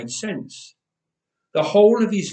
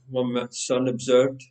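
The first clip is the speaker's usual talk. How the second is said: plain reading voice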